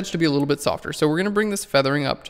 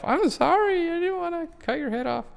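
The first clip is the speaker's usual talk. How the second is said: much higher, cutesy sorry voice; mock apology. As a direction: apologize to the picture in a high, babyish voice. Apologizes to the photo subject.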